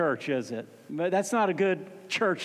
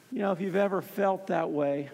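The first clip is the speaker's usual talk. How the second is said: ordinary talk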